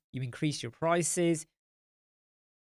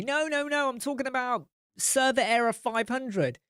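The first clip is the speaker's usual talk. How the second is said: exasperated explaining; 9 semitones higher than usual. Retold or imitated. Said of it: "she'll be like".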